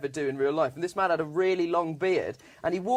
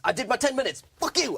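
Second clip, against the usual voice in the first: a higher mock shout flung back over the shoulder; defiant parting shout; 7 semitones higher, faster than usual. Comic shouts back at the hostile crowd while fleeing, high mock shout. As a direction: get the last word in as you run. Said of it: "but not before shouting at the audience".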